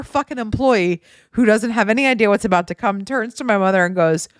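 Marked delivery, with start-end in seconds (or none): none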